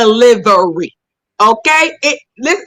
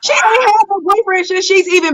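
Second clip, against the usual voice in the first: raised, high, punchy retort; emphatic shout; 7 semitones higher than usual. Real, not acted. Mocking a dating advisor, with an emphatic shout.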